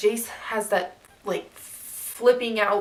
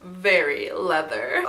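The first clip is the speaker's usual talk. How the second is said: normal talking voice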